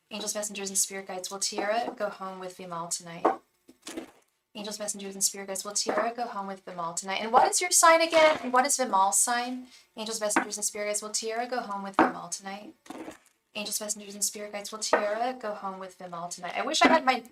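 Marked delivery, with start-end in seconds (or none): 0.1-7.2 soft, even incantation; hushed tarot reader's chant; murmured spirit invocation. Acted. 10.0-16.5 soft, even incantation; hushed tarot reader's chant; murmured spirit invocation. Acted. Repeats the invocation.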